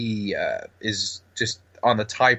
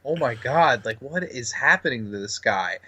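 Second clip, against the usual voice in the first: ordinary talk, nothing marked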